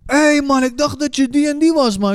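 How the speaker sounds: high-pitched imitation of a distant caller; mock far-off call in a high voice. Balcony man calling down, acted.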